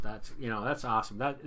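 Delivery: usual speaking voice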